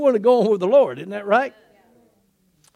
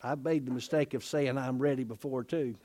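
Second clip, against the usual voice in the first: much softer, lower confession; quiet aside; 8 semitones lower, 11 dB quieter than usual. Real, not acted. Preacher quietly confessing his own past boast, hushed. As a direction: drop the voice to admit a personal mistake.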